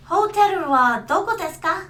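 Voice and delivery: anime-learned Japanese accent. Shown as a demonstration. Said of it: "I can do a Japanese accent".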